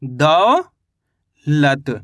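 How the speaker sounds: दौलत split in two, दौ stretched and rising, then a gap and falling लत; teacher slowly sounding out दौलत in two parts; syllable by syllable. Shown as a demonstration. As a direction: draw out दौ upward, pause, then drop on लत. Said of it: "तो मिलके बन गया ये".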